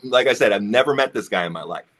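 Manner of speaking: ordinary delivery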